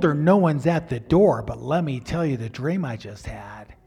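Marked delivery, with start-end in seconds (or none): 1.4-3.7 hushed, breathy whisper. Real, not acted. Husband whispering to his wife about the dream. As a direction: lean in and whisper it.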